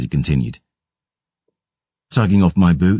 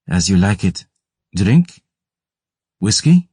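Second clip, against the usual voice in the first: hopeful, rising higher on each word; 4 semitones higher than usual. Acted. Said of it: "I asked hopefully".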